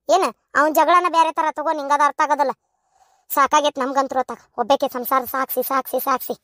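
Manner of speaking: normal talking voice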